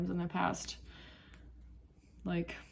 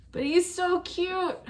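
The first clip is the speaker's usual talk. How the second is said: slow, much higher cooing at the dog; 11 semitones higher, 6 dB louder, slower than usual. Real, not acted. Cooing over her dog.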